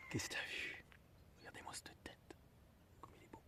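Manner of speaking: very soft whisper to an animal; hushed whisper. Real, not acted. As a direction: whisper so as not to disturb it. Talks softly to an animal eating. (chuchoter tout doucement)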